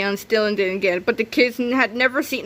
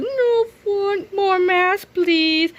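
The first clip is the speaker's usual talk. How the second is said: high whimpering plea; high whiny kid voice; 9 semitones higher, much slower than usual. Acted. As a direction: whine like an upset kid. Stone-faced kid voice.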